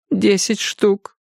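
тихий медленный старческий голос; пристыженная пожилая женщина; виноватая старушка. A narrator's reading. Jenny ashamed. (голос виноватой старушки)

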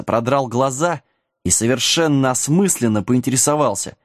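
ordinary delivery

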